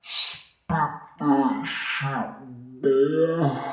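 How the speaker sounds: put-on high, stretched-out hype in Bubba's accent. Bubba's accent hyping Dirty's rap. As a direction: egg on a rapper in Bubba's accent. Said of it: "that's the best I can do his accent".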